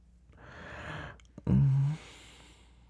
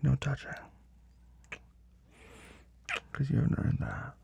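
whispering; 6 semitones higher, 6 dB quieter than usual. Acted. Teasing denial in intimate scene.

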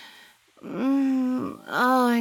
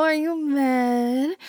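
usual speaking voice

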